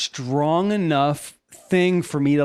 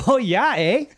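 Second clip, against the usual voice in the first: put-on Canadian accent; 5 semitones higher than usual. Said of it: "If it's a Canadian".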